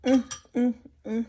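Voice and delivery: savoring mm with mouth full; appreciative hums while tasting; eating soup. Real, not acted.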